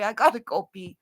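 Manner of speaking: usual speaking voice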